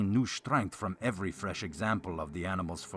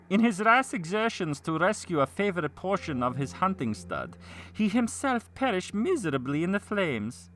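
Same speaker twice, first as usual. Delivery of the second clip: high theatrical vassal voice; hammy stage actor; 10 semitones higher, 4 dB louder than usual. A narrator's reading. Vassal voiced in narrated tale.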